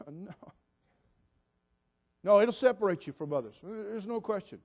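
ordinary delivery

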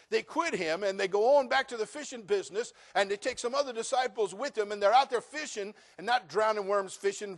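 loud, rising preacher's build; fiery sermon voice; preaching at full force. Acted. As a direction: drive the story home from the pulpit. Preaching the fishing story.

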